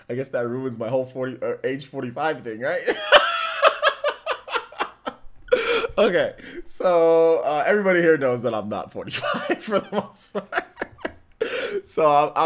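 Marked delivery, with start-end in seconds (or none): 2.9-5.9 loud, high laugh; big laugh. Real, not acted. Streamer caught lying about his age, loud laughter. 6.0-10.6 loud words through laughter; laughing while talking. Real, not acted. Streamer admitting his real age, laughing through it.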